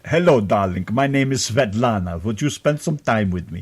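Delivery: put-on Russian woman's accent. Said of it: "like Russian women's accent".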